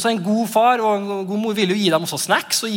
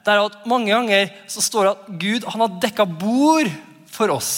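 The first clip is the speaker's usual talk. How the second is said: usual speaking voice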